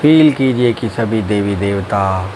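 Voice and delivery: low, slow drawn-out meditation voice; guided meditation. Acted. Teacher guiding friends through breathing and relaxing, guided meditation.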